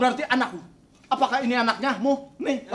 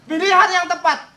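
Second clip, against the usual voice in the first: melodramatic proclamation; 6 semitones higher, 8 dB louder than usual. Acted.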